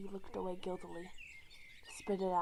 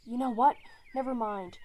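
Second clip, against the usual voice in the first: flustered; 3 semitones higher, 8 dB louder than usual. Acted. Flustered outburst.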